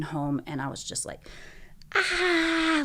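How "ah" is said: a sudden high cry, not very loud; reliving the scream in the car; retold scream. Alone in the car after the surgeon's news, a high mock scream. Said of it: "screaming as loud as I could".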